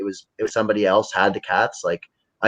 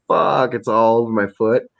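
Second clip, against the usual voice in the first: ordinary talk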